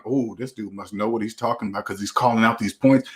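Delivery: a low, quiet, awed take on a gullible viewer; mock naive viewer. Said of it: "you would look at that and think".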